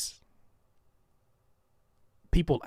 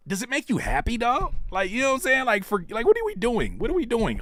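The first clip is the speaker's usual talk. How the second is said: pitched-up exasperated what are we doing; exasperated rant; 10 semitones higher than usual. Real, not acted. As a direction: plead with them to wake up. Exasperated ranting at peers.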